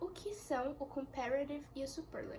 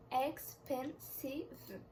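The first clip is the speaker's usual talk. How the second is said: slow syllable by syllable; 3 dB quieter, much slower than usual. Shown as a demonstration. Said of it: "possui quatro sílabas".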